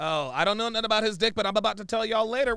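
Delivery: fast, high, loud mimicry; mocking parrot. Mockingly repeats the clip woman.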